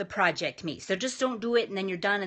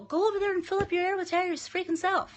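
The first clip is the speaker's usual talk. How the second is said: mock urging; 9 semitones higher, faster than usual. Voices what a girl should say.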